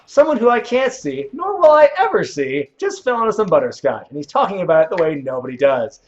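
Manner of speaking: high, loud hammed-up comedian bit; Patton Oswalt. Streamer quoting a favorite comedian, high loud comic rant easing off. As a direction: start shrieking high, ease down as it goes. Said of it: "Patton Oswalt's one of my favorite comedians".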